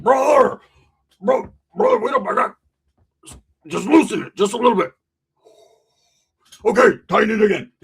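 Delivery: mock-strangled gasping; choked friend pleading. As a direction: beg while being choked.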